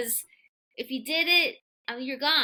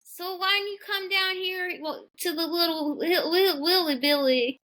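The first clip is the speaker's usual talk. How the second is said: country accent; 6 semitones higher than usual. Shown as a demonstration. Wife shows off her country accent on request, hammed-up Southern twang. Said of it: "Let me hear your country accent real quick".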